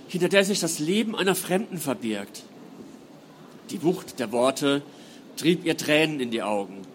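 measured, dramatic reading voice; story narration. A narrator's reading. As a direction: read it like an audiobook. Author reads novel prologue aloud.

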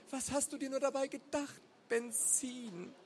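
high-pitched incredulous scolding; reproachful. A narrator's reading. Wife to husband over the petrol, reproachful. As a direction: scold in disbelief, pitched well up.

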